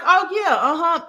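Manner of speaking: high, lightly voiced agreeable local; agreeable stranger. Strangers agreeing to give the dreamer directions, agreeable.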